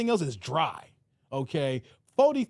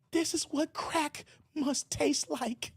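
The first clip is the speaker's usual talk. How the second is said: high, slow, hushed cartoon-kid voice; a kid stunned by the taste of a burger, said slowly; dazed Riley; 9 semitones higher, 7 dB quieter, slower than usual. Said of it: "He was like".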